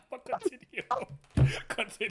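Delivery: laughing. Real, not acted. Laughing at friend's interruption.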